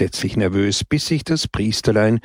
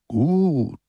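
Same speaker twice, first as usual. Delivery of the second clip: drawn-out, higher-pitched agreement; crowd assent; 5 semitones higher than usual. A narrator's reading. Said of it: "stimmte die Menge zu".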